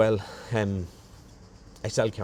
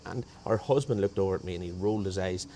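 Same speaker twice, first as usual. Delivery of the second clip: normal talking voice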